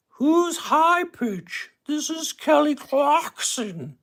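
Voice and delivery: very high, slow falsetto. Retold or imitated. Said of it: "I like doing the voices".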